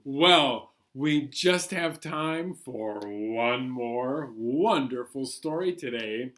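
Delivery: usual speaking voice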